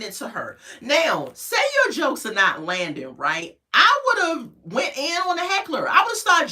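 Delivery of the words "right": usual speaking voice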